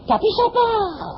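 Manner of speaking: lightly voiced wife, high and slow; a hint of a timid wife; wife's voice. Wife voiced in retold story.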